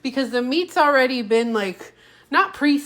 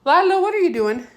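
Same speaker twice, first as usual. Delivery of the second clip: raised, higher call across the room; calling the dog; 7 semitones higher, 5 dB louder than usual. Real, not acted. To her dog Lila, a raised higher call. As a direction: call out to catch the dog's attention.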